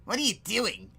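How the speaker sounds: ordinary talk, nothing marked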